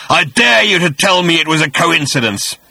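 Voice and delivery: loud daring creature voice; menacing dare. A narrator's reading. The voice dares Arthur.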